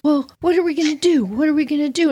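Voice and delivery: put-on high, fast panicky kid voice; panicky sister. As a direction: fret aloud like a scared older sister. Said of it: "my sister's looking around".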